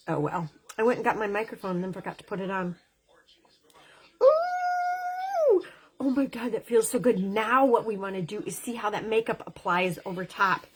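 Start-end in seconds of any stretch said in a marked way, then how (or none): 4.2-5.6 a high, delighted squeal of pleasure. Real, not acted. Makeup vlogger squealing at how good the product feels.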